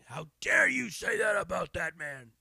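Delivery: the tipsy host shouting down a comic, loud; loud retold shout. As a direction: bellow it like an angry host.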